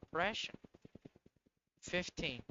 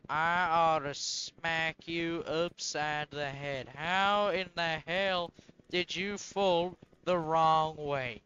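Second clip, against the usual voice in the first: slow, louder mock-stern telling-off; mock parental scolding; 8 dB louder, slower than usual. Builder scolds the plane like a disobedient kid, slow and loud. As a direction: scold it like a cross dad, slowly and loudly.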